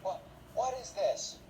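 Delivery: hammed-up mother losing it; a hammed-up mom shouting at her son; outraged mom. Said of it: "the mom's like".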